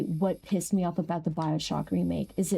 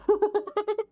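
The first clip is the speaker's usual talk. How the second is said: high, squealed What?; stunned outburst of disbelief; shocked; 12 semitones higher than usual. Real, not acted. Streamer hearing a crazy speedrun trick, shrieking in shock.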